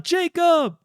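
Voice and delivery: high call of Jacob; yelling a name. Retold or imitated. Mother calling her husband during the break-in, high call. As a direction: call him from across the house. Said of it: "She yells out".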